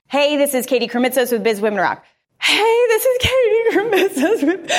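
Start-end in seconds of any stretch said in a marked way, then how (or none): 2.4-4.7 high, slow, half crying and half laughing; mimicking her own tearful first intro, giggling; tearful laughing retake. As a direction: replay the crying first take through a laugh. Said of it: "I am crying on my first".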